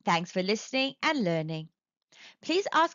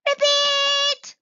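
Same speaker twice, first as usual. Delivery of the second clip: very high, loud failed ribbit; a tadpole tries his first ribbit; squeaky ribbit; 8 dB louder than usual. A narrator's reading. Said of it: "opened his mouth and".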